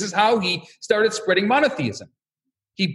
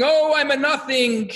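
hammed-up chant held on one high note; mock wailing cry; 7 semitones higher than usual. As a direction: wail it long and high, imitating a student's lament. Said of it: "And the other one is screaming".

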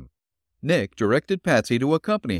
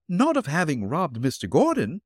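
higher, disbelieving interruption; incredulous; 7 semitones higher than usual. Acted. Landlady cutting in on the detective, incredulous. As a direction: cut in, unable to believe it. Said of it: "interrupted the landlady incredulously".

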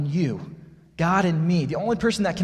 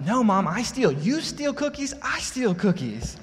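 put-on high, slow indignant child voice; a small child insisting hotly; four-year-old digging in her heels, much higher; fired-up little girl; 8 semitones higher than usual. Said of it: "Blair just gets fired up".

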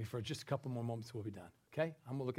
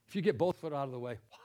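ordinary talk, nothing marked

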